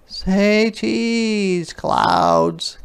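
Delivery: put-on high, cheerful sing-song girl; a child happily posing the clouds; little girl voice. Ruthie playfully asks the clouds to smile, high sing-song. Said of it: "She tried it out".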